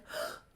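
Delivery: acted gasp of alarm; shocked gasp. Charlotte notices Leif's wound, gasping.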